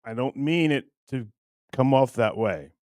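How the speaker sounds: normal talking voice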